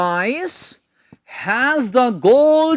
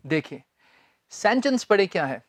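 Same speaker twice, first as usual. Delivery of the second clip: ordinary delivery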